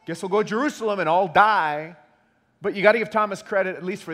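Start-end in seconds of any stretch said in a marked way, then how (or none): 0.0-2.0 loud, higher-pitched mock resignation; exaggerated sardonic "oh well"; sarcastic. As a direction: toss it out as if dying were no big deal. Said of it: "if he's being sarcastic".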